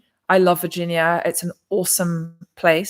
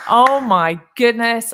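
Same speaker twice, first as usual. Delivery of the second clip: slow, loud oh my goodness; surprised; 4 semitones higher, 5 dB louder, slower than usual. Real, not acted. Reacting to a viewer's donation, stunned and loud. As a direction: let the surprise land slowly.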